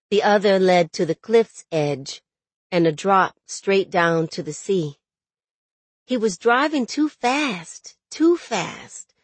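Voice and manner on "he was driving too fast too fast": higher, urgent narration; rising alarm. A narrator's reading. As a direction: lift the voice in dread on the repeated warning. Nightmare of truck nearing cliff.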